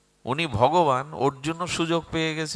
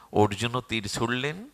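ordinary talk, nothing marked

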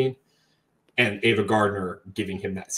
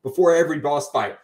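ordinary delivery

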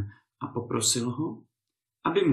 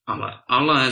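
loud mocking exclamation; exclaiming; 7 dB louder than usual. A narrator's reading. Lord scoffs loudly at the wife's story. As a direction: call it out in disbelief. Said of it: "zvolal pán".

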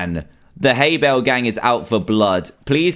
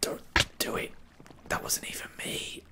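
breathless whisper, barely audible; holding breath; 19 dB quieter than usual. Real, not acted. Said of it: "I'm holding my breath".

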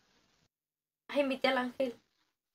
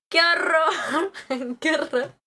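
mock horror; 3 semitones higher than usual. Mock disgust at donation.

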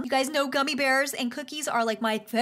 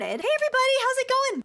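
greeting pitched well higher, extra bubbly; 8 semitones higher, 4 dB louder, faster than usual. Real, not acted. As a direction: throw the hello up high and bright.

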